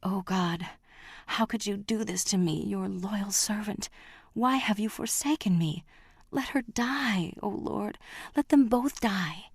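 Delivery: broken father praying for his daughter's death; desperate prayer. Acted. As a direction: pray as a betrayed man begging. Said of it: "He knelt and prayed".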